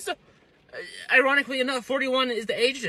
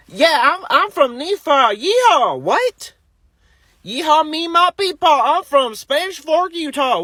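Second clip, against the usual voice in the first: hammed-up Southern Utah country accent; 7 semitones higher, 4 dB louder than usual. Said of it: "like, a southern accent?".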